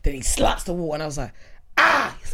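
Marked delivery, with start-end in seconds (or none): 1.8-2.2 fake cry.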